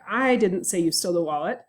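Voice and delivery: "I" stressed, the rest trailing after it. Shown as a demonstration. Lecturer showing students how stress changes meaning, stress on I. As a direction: lean on the first word, "I". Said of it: "if I give emphasis or stress to different words".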